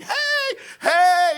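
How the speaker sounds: high, loud mock crowd shouts; yelled bar greetings. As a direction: holler like regulars greeting each other. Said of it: "like, screaming and yelling".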